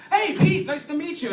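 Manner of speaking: high-pitched, chirpy greeting; evaluator. Acted. Evaluator greeting cop at psych exam, high chirpy voice. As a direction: greet a patient in a high put-on voice. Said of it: "the guy must have been like".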